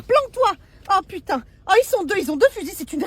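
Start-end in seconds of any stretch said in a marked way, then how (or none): 0.0-1.4 panicked yelp. Acted. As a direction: shriek planque-toi in mock panic. Panicked hiding.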